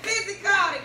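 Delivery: loud laughing repeat of the word; laughing shout. Real, not acted. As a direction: shout it back through laughter.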